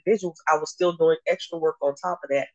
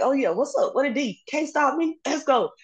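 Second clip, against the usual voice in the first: higher, cocky taunting after a bucket; smack talk; 10 semitones higher than usual. Retold or imitated. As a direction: gloat at the defender you just scored on. Retold smack talk while scoring.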